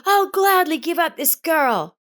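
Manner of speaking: loud, high spiteful shout. A narrator's reading. Rita runs up and yells at Elsa, spiteful shout. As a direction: fling it at them, spiteful. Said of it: "and shouted".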